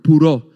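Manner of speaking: "puro" said loud and clear as poo-ro; Greek word puro. Shown as a demonstration. Pastor teaching the congregation a Greek word, loud and clear. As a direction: stress "puro" firmly, rolled r. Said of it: "That's the Greek word".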